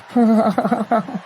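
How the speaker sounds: slow seductive chuckle; Bill Clinton. Acted. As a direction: chuckle suggestively, in a steady run. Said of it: "trying to seduce the audience".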